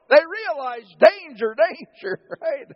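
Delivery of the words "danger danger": loud, high mock warning; mock alarm. Disciples realizing danger, mock loud alarm. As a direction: sound the alarm, half joking.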